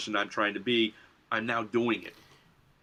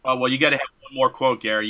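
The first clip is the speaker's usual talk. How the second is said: normal talking voice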